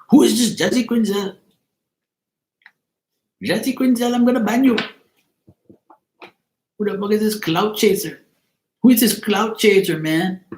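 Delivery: high-pitched, indignant imitation of his accent; mock accent impression. Mimicking a man ranting about Jesse Quinzel, mock accent. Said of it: "His, his real accent".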